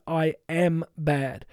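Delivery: slow, heavy self-condemnation; voice of shame. Acted. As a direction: let it sink like a confession. Voicing what shame says.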